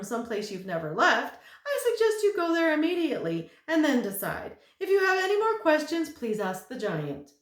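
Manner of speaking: very high, loud, fast chatter; giddy thin man. Acted. Thin man gushes to Milo, high and fast. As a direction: gush without stopping for breath. Man gives his riddling advice.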